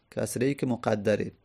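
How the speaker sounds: normal talking voice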